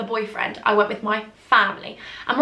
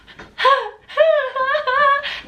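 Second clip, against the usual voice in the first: high, cringing laugh; embarrassed squealing laugh; 3 dB louder than usual. Real, not acted. Teased by the boys on the ride, squealing laugh.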